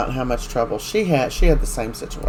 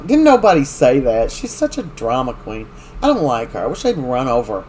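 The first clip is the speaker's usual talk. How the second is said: normal talking voice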